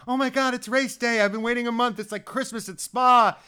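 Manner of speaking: put-on a touch of kid on Christmas morning; childlike excitement. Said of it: "I'm like".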